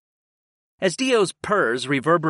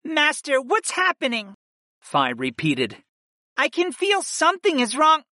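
high, anxious female character voice; alarmed plea to a master; high-pitched worry; frightened goddess; 12 semitones higher than usual. A narrator's reading. Frightened goddess echoes frantic question.

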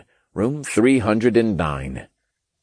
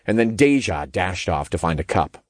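ordinary delivery, nothing marked

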